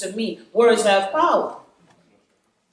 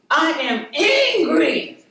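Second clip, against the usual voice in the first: imitating a toddler; 7 semitones higher, 3 dB louder, slower than usual. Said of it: "he identified the emotion".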